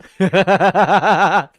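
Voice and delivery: loud rapid-fire cackling laugh. Real, not acted. Streamer reacts to a jerky code joke, loud cackle. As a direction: burst into a sustained, pulsing laugh.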